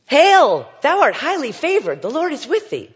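put-on overwhelming heavenly announcement to a young woman; booming angel. Shown as a demonstration. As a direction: thunder it like a towering angel. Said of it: "Here's this young woman".

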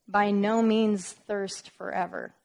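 "By no means thirst forever" slow, each word weighted; emphatic. Shown as a demonstration. Preacher stressing the Greek to the congregation, slow and emphatic. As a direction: lean hard on "By no means", slowly. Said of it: "do you see the emphasis there".

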